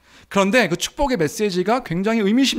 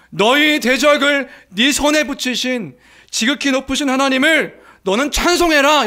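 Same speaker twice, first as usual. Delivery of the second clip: higher, louder ringing proclamation; proclaiming cry; 5 semitones higher, 6 dB louder than usual. Acted. Priest cries out to Abraham, loud proclamation. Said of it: "외치는 외침이 뭐냐면".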